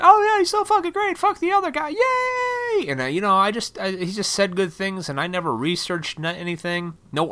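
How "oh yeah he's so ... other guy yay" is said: high falsetto gushing fanboy, hammed-up; mock cheerleader voice, way up high; mocking his naive younger self. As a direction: squeal it like a dumb starry-eyed fan. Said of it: "I was like".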